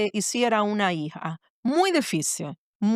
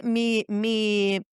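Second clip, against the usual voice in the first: normal talking voice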